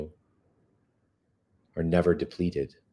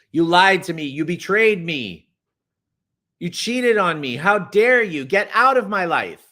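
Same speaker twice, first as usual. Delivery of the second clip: high, loud furious scolding; angry accusation; 11 semitones higher, 6 dB louder than usual. Shown as a demonstration. Coach acting out yelling at money as at an ex. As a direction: rage at money like a cheating ex. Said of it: "I want you to say that to money".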